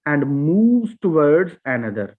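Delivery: very slow, word by word reading; slow dictation. Shown as a demonstration. Teacher reads the definition line to the class, very slowly. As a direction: read it out slowly for students to follow. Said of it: "sound शुरू तो होती है एक वॉल से".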